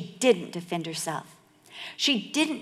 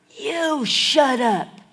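loud, high, slow yell; shouted comeback; 5 semitones higher, 8 dB louder, much slower than usual. Retold or imitated. Biker shouting back at the dog walker, loud retort. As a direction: throw it back hard, stretching the words.

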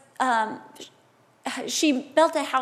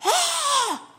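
high-pitched wailed "ah"; acted cry of anguish, pitched way up; 14 semitones higher than usual. Grieving mother who skips the polite request, high wail. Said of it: "She's like".

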